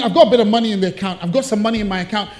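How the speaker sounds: fast, eager self-reassurance; hopeful rush. Retold or imitated. Person at the bank convincing themselves, fast. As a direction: rattle it off hopefully. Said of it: "you think".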